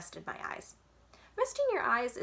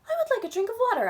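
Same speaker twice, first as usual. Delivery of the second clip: high, loud and fast ask; a thirsty kid's excited announcement; eager request; 8 dB louder, much faster than usual. Acted. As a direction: blurt it out all at once. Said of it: "I said".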